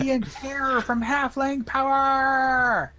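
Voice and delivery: high, gleeful gloating yell; triumphant taunt. Acted. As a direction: crow it at the fleeing foe. Taunting fleeing zombie, shouting.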